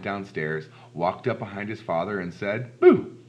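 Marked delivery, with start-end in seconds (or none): 2.8-3.2 high, loud sudden boo; startling boo. Acted. Lance creeping up behind his father to scare him, startling boo.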